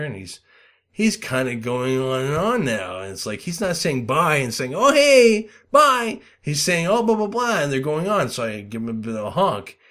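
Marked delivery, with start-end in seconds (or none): 4.7-6.2 high, loud sing-song mocking voice; mock chatty goodbye. 6.9-7.6 high sing-song blah blah; mock chatter. Mocking the chatty driver.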